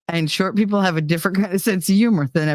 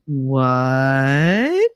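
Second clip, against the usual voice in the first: drawn-out disbelieving question, swooping up; stretched, rising mock disbelief; mock incredulous puppet; 4 semitones lower than usual. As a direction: imitate a puppet's stunned double take. Puppet turns to stare at him.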